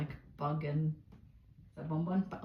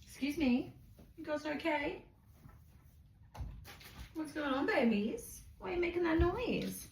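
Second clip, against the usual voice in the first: much higher, playful voice to the pets; 12 semitones higher than usual. Real, not acted. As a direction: ask the squeaking pigs what's wrong. Talking to squeaking guinea pigs.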